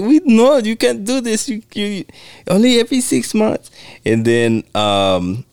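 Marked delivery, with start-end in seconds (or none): 0.0-3.7 high, flustered imitation of her pleading; hammed-up high pleading; mock frantic voice. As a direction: squeak it out in a put-on panic. Quoting a character's frantic plea.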